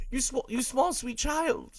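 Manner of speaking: very high-pitched gushing; squeaky, adoring plea; overcome cooing. Acted. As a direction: coo helplessly over a little child. Gushing over the child actor.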